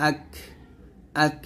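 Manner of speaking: "আক" with a puff of air after the k; hams on kaf. Shown as a demonstration. Teacher showing hams to learners, airy final k. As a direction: release the final ক of "আক" with breath. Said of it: "এখানে কাফটা হামস হবে".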